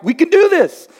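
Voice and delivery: high-pitched rallying cry; rally yourself for a fight; fired-up fighter. Acted. Preacher acting his own response to yelling, fired up. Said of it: "When I hear somebody yell at me".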